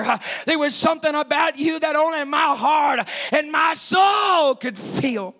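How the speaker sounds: high, driving preacher's delivery; full-force preaching. Real, not acted.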